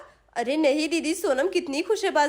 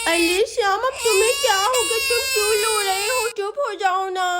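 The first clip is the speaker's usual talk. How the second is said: put-on high, raised babyish plea; exasperated little girl; 5 semitones higher, 3 dB louder than usual. Chinki begs crying Shyam to hush, exasperated child voice. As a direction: plead over the wailing like a flustered child.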